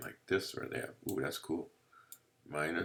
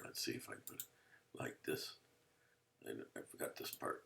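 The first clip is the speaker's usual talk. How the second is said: muttered to self; 7 semitones lower, 16 dB quieter than usual. Real, not acted. Muttering while drawing.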